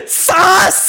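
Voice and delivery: high yelled sus; shouted meme. Pretend. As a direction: yell it like a meme.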